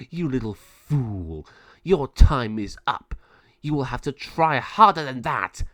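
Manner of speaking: slow, loud sneering witch voice; taunting villain. Acted. Raven villain taunts the young heroes, slow loud sneer. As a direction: drag out the threat with menace.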